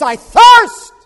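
high, piercing scream; anguished cry. Acted. Preacher voicing Jesus' thirst, piercing scream. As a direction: wail it from the cross. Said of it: "He says".

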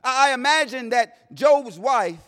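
normal talking voice

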